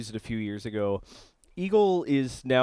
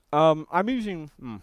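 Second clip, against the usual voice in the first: talking with a mouth full of food; words round a bite of food; 4 semitones higher, 4 dB louder than usual. Real, not acted. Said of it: "pardon me talking with my mouth full".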